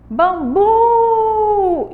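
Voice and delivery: Bambu with "bu" high, loud and held long; stress on last syllable. Shown as a demonstration. Teacher has pupils listen to the sound, long stressed "bu" in Bambu. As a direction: call out Bambu, stretching the final "bu". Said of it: "Escuta o som".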